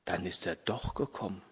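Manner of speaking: very quiet, low realization; hushed, low, dawning wonder; soft murmur. A narrator's reading. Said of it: "murmelte Vater Martin leise".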